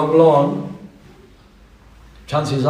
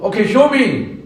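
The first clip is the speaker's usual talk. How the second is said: higher, cocky dare; cheeky demand; 7 semitones higher than usual. Acted. Small fish daring the big fish to show, cocky. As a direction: throw down the challenge.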